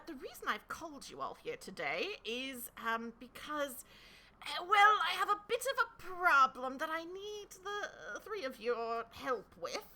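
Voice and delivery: lightly voiced fussy king; a hint of a worried king.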